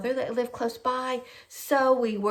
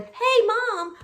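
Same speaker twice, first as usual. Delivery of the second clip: high-pitched retold phone greeting; son calling Mom; 11 semitones higher, 4 dB louder than usual. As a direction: imitate a son calling out to his mother. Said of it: "it's my son going".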